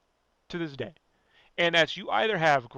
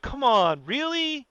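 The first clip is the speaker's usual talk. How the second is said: higher, louder incredulous protest; exasperated disbelief; 7 semitones higher, 3 dB louder, slower than usual. Retold or imitated. Viewer reacting to April naming the turtles, exasperated disbelief. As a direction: push it up into a disbelieving question. Retold disbelief at contrived backstory.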